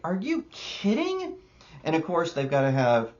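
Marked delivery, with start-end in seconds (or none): 0.0-1.4 squeaky high "are you kidding"; incredulous, pitched way up; mock disbelief. Reviewer recalling boredom at the exposition dump, squeaky disbelief. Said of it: "I was like".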